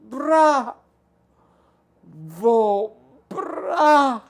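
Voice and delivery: high, loud cry that breaks off; sobbing cry. Acted. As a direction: call to him through tears.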